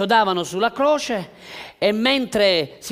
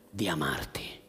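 hushed whisper; 13 semitones lower, 9 dB quieter than usual. Acted. Pastor whispering God's choice to love the listener. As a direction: whisper it, lower and softer. Intimate climax of sermon point.

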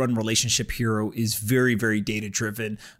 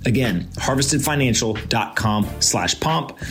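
punchy, louder podcast sponsor read; 5 dB louder than usual. Acted. Host reads sponsor ad.

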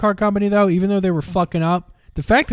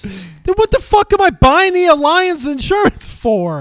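outraged rant; 9 semitones higher than usual. Real, not acted. Outraged rant about insurance.

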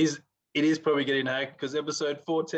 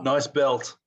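ordinary talk, nothing marked

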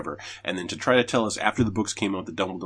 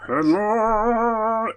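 high, loud headline call; announcer shout; 14 semitones higher, 7 dB louder than usual. Pretend. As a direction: bark it out like a show host.

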